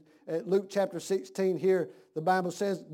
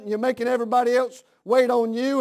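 ordinary talk, nothing marked

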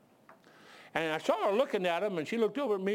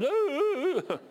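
high, loud repeated "ooh"; hammed-up juddering hoot; high whoops imitating a fit; mock convulsive whooping; 11 semitones higher, 5 dB louder, much slower than usual. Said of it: "I thought he was epileptic".

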